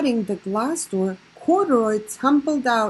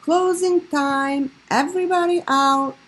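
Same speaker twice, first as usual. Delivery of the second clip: calling out; 5 semitones higher, 3 dB louder than usual. A narrator's reading. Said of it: "the manager of the laundromat called".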